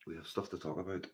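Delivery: quiet, loaded promise of what's coming; ominous hush. Shown as a demonstration. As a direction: let the repeat sink low and heavy. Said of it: "And we have stuff to talk about".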